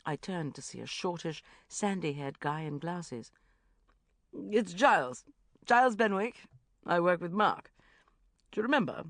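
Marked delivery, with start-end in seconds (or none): 4.3-9.0 louder posh Giles; polite posh introduction; posh English voice. A narrator's reading. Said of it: "a posh voice".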